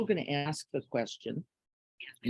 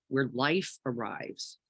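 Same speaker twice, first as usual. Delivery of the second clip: ordinary delivery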